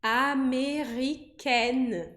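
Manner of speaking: américaine said very slowly with an open en ending, no nasal; French américaine. Shown as a demonstration. Teacher modeling the feminine américaine. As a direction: draw out américaine, ending on a clear ken. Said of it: "eu vou pronunciar américaine".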